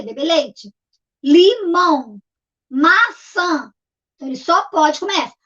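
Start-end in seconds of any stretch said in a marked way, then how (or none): 1.2-3.7 each word in two separate beats, LI, MÃO, MA, SÃ; syllable by syllable. Shown as a demonstration. Teacher lists two-syllable foods, syllable by syllable. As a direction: split LIMÃO and MAÇÃ into syllables. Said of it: "têm duas sílabas".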